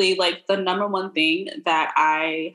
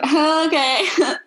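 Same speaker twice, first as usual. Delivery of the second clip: mock surprise; 7 semitones higher, slower than usual. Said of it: "So I was like".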